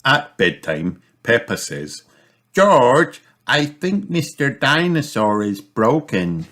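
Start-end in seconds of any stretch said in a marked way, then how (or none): 2.5-6.5 high-pitched little sister voice; child character speaking up. Acted. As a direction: pipe up to a little brother, high and insistent. Peppa voiced to her brother.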